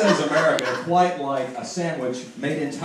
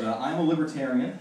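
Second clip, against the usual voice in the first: ordinary delivery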